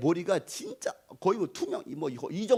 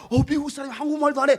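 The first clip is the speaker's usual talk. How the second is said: put-on amazed kids; 7 semitones higher, 7 dB louder, faster than usual. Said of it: "그러면".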